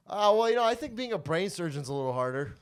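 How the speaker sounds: high and loud mock-polite answer; overly polite reply. Comic to a sweet lady at the baptism, loud mock politeness. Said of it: "my response was".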